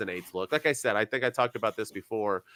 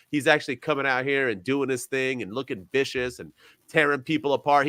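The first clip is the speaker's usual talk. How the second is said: ordinary delivery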